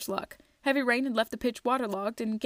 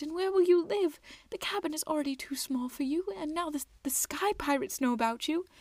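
tearful mother; 6 semitones higher than usual. A narrator's reading. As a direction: speak through held-back tears. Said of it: "She was weeping".

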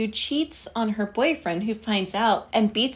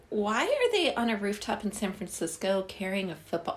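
usual speaking voice

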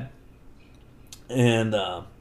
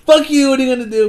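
much higher, louder, fast snarled taunt; taunting villain; 10 semitones higher, 8 dB louder, much faster than usual. Retold or imitated. Reggie Veggie taunting Darren, fast loud sneer. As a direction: rattle off the threat in a sneer.

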